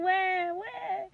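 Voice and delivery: high mock newborn wail; imitated baby cry. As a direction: wah like a crying newborn. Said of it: "she's crying".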